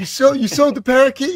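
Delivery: high, loud disbelieving question; imitating someone aghast at the news; shocked disbelief. Acted quote from movie scene.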